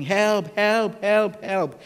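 high, loud calls of help, repeated; preacher acts out a man over the cliff shouting help; retold desperate cry for help. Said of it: "and he was shouting".

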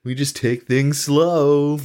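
slow, drawn-out mock Southern accent; mock Southern drawl. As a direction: stretch it out in a joking Southern drawl. Mocks slow Southern speech in acted quote.

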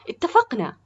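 "اتفقنا" high and loud, falling at the end; enthusiastic. A narrator's reading. Boy accepts the cooking job, "اتفقنا" said enthusiastically. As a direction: seal it eagerly with a loud "اتفقنا". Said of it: "أجاب براء بحماس".

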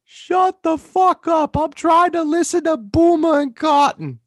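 screeched, drawn-out Long Island yell; exaggerated Long Island accent. Spouse trying to hear the radio, screeching Long Island accent. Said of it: "your exaggerated Long Island accent sounds part Boston".

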